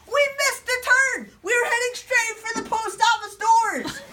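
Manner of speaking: high, loud storyteller's alarm; panicked exclamation. Acted. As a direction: cry out as if about to crash. Bus heading for crash.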